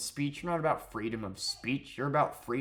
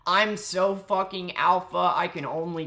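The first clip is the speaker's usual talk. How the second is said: mock alpha voice; 7 semitones higher, 6 dB louder than usual. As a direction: ham up a macho brag. Mocking alpha streamers.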